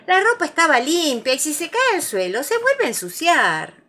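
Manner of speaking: high, loud fussing complaint; scolding mother. A narrator's reading. Kangu to Tiger about clean washing, scolding. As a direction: fuss like a vexed mother.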